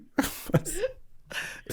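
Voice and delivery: high wheezy laughter; breathy laugh. Real, not acted. Laughing at own retold line.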